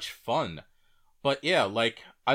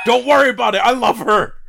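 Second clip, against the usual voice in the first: loud, high, laughing gush; excited anime fan; 10 semitones higher, 13 dB louder, faster than usual. Real, not acted. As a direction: shout your love for her. Gushing over anime waifu.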